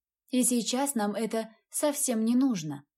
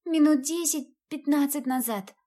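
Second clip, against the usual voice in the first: a touch of tearful sniffling; lightly sobbing child voice; sobbing girl; 5 semitones higher than usual. Acted. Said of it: "всхлипывая".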